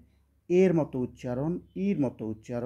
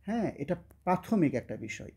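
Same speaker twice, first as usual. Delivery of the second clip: ordinary delivery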